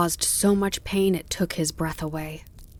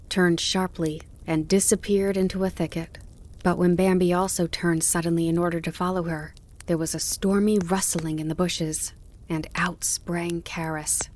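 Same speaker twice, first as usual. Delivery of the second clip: usual speaking voice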